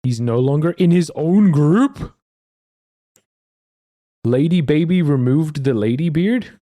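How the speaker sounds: loud, high incredulous questioning; loud outburst of disbelief; shocked disbelief. Real, not acted. Shocked at news of group split.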